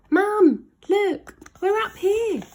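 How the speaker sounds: high, loud excited call. Acted. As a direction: shout down to get mum's attention. Children calling to mother below.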